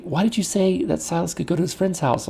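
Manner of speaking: high, pointed wife's question; lightly hammed-up wife quizzing her husband. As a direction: imitate the wife, high and accusing. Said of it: "she'll say".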